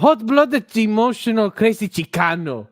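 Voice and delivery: hot-blooded Latin accent, played up. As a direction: ham it up as an impassioned Latin lover. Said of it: "read this for me in your Latin voice".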